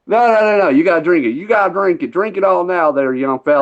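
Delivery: lightly voiced Southern local; Southern accent. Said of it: "They all sound goofy as hell down here".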